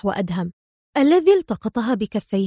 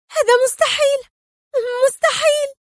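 very high, stunned protest with a pause; trembling disbelief; 4 dB louder than usual. A narrator's reading. Sonia stunned by Frank's true identity, trembling disbelief. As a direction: gasp it out as if aghast. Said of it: "وبدا صوتها مرتجفا وهي تغمغم".

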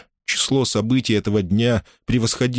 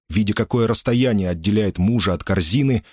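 plain reading voice

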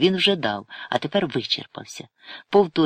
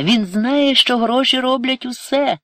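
high, loud wail; tearful; 12 semitones higher, 6 dB louder than usual. A narrator's reading. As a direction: cry it out, much higher and louder. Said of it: "заплакала Ірина".

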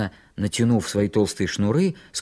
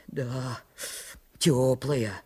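grumbling; 6 dB quieter, much slower than usual. A narrator's reading. Митька steps gingerly into cold water, grumbling. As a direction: grumble the doubt softly. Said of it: "ворчал Митька".

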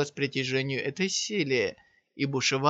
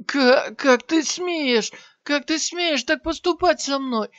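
pained scream, much higher; 11 semitones higher, 5 dB louder than usual. A narrator's reading. Armless warrior screams threats at Shiyan in pain. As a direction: howl the threat through the agony. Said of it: "закричал воин".

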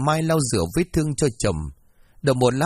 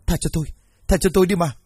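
higher, louder pleading for mercy; terrified man begging to be spared; desperate begging; 5 semitones higher, 3 dB louder, faster than usual. A narrator's reading. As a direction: beg for your life.

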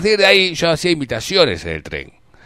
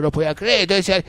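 ordinary talk, nothing marked

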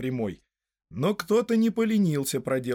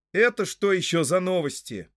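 raised-voice challenge, higher pitch; loud question; 7 semitones higher, 5 dB louder than usual. A narrator's reading. Frodo challenges the sheriffs, loud and high. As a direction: demand it loudly. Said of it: "громко спросил Фродо".

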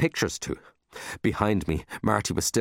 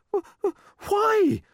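very high, stammered disbelief; stutter, then stretch out the question; stammering; 3 dB louder, slower than usual. Acted. Amazed child questioning the unexpected reward, stammering. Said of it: "I stammered".

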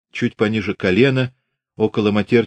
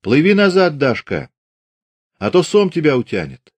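calling out laughing; 7 semitones higher, 3 dB louder than usual. A narrator's reading. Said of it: "смеясь, звала Дарью".

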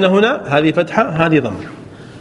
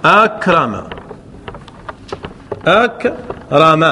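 slowed teaching demo; 3 semitones lower, much slower than usual. Shown as a demonstration. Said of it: "الآن أكرم أو أكرم".

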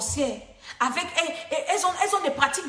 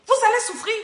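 louder, higher warning; 9 semitones higher, 4 dB louder than usual. Real, not acted. Preacher repeats the warning, raised.

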